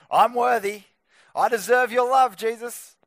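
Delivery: loud, mock-entitled claim; self-righteous. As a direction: act out someone proudly demanding what they deserve. Acting out a self-righteous approach to Jesus.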